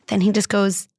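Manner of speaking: usual speaking voice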